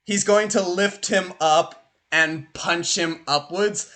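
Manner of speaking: ordinary talk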